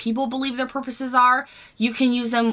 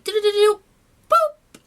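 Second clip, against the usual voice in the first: playful sound effect; 12 semitones higher, 4 dB louder than usual. Pretend. Mimics product flipping chapstick away.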